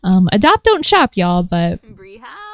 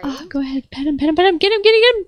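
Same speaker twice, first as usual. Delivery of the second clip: pitched way up, cooing over a puppy; high cooing; 7 semitones higher than usual. Real, not acted. Gamer cooing high at her new pet dog. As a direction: coo at the new dog in delight.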